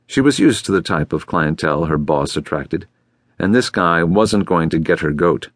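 plain reading voice

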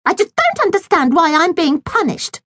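high, complaining little sister; whiny girl voice. A narrator's reading. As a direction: whine it like a spoilt girl. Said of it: "said Millie".

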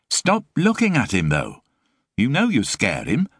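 put-on a harried young mum scolding her lodger; exasperated Sue. A narrator's reading.

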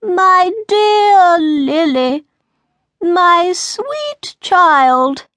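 high, very slow, loud pleading cry; frantic queen. Acted. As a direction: wail for the lost child. Frantic queen calls her child.

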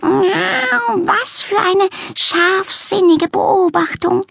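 high, very slow, swooning praise; gushing cat lady. A narrator's reading. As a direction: gush like an adoring aristocrat. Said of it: "schwärmte sie".

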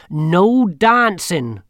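put-on slow, loud stern old witch; stern warning. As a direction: warn it, very slowly. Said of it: "Granny had warned".

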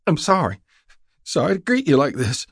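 high-pitched, upset country woman; a frightened young wife's high voice; distraught young woman. A narrator's reading. Startled woman apologizes to the rider she aimed at, distraught.

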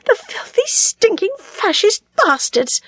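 high-pitched, tearful outrage; furious cursing. A narrator's reading. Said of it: "she cursed".

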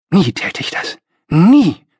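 emphatic refusal, second Nie high and loud. A narrator's reading. Young idealist refuses to bow to anyone, emphatic. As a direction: punch the final Nie with indignation. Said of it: "sagte ich emphatisch".

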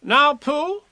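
high, officious call; bossy little animal; Rabbit taking command. A narrator's reading. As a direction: summon a friend in a high, commanding voice. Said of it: "time he took command".

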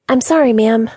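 high, stammered, a touch of fear; bright voice with a trace of fear; timid apology. Acted. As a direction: apologize nervously to the boss. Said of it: "a trace of fear in it".

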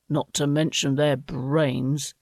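usual speaking voice

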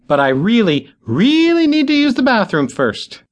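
very high pleading "really, really"; high desperate plea; urgent. A narrator's reading.

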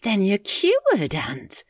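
high, slow, hopeful question; a touch of eagerness; eager. Acted. Mary hoping her aunt is cured, eagerly. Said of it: "Mary clasped her hands eagerly".

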